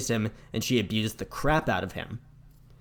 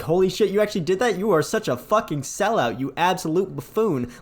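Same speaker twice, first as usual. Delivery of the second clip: higher, louder gloating jeer; mocking villain; 7 semitones higher, 3 dB louder than usual. Handsome Jack jeering at the player, high and loud. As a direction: crow at the player like a smug villain. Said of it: "he starts laughing as".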